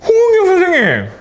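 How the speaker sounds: high, loud delighted call of a name; excited shout to the teacher. Real, not acted. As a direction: call her name out, thrilled. Calling out a quick answerer.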